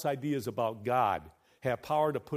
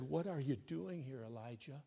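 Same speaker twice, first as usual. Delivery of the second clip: soft, hushed question, much softer; God's still small voice; 10 dB quieter than usual. Acted. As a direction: ask it gently, barely above a breath. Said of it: "with a still small voice".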